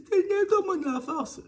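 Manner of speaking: high crying prayer; desperate prayer. Retold or imitated. Mother in labor praying to God, crying out. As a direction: beg God for strength, voice breaking. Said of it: "je commence à prier".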